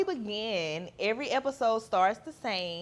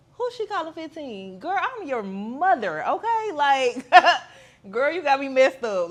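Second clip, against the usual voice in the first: higher, louder sassy retort with a laugh; sassy clapback; 5 semitones higher, 6 dB louder than usual. Retold or imitated. Mother retorting to her teenage daughter, sassy and laughing. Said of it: "she told me".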